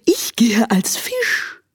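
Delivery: slow secretive whisper. Acted. Owl answers secretively. (geheimnisvoll geflüstert)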